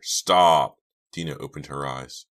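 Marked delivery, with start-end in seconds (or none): 0.0-0.8 loud, anguished shout; frantic yell; desperate cry. Acted. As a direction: cry out to stop him. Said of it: "Chuck's desperate cry".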